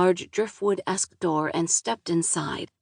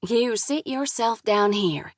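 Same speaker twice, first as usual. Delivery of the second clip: slow southern drawl; 4 semitones higher, slower than usual. Acted. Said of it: "thick southern drawl".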